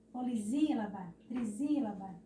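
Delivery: polisílaba, trisílaba as po-li-ZÍ-la-ba, tri-ZÍ-la-ba. Shown as a demonstration. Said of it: "um S entre duas vogais tem som de Z". (polisílaba, trisílaba com som de Z)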